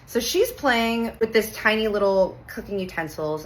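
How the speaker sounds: ordinary talk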